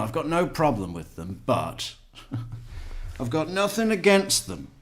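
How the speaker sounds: a touch of a bigot's tolerant claim; lightly voiced smug bigot; mock bigot quote. Mocking people who say they have no problem with them, in a mock smug voice. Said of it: "people that say".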